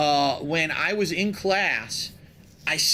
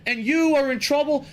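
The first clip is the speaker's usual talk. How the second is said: higher, mildly louder scolding teacher; angry teacher; 7 semitones higher, 3 dB louder than usual. Retold or imitated. Teacher scolds innocent pupil, higher, mock angry. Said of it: "ripped me a new asshole".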